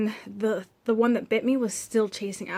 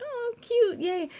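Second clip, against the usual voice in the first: very high, cutesy squeal; imitating delighted baby-talk; mock cooing; 13 semitones higher, much slower than usual. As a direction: coo at an adorable little dog. Retells cooing at the dogs.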